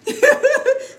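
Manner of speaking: short burst of high-pitched laughter; real giggle. Real, not acted. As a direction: let out a quick high giggle. Still amused about the shouting.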